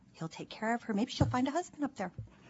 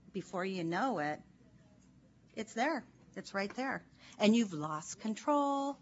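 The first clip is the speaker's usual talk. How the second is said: ordinary delivery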